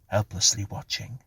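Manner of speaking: plain reading voice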